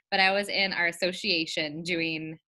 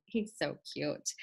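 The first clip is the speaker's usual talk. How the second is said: soft tender gush; 5 semitones lower, 8 dB quieter, slower than usual. Real, not acted. Repeats softer, slower.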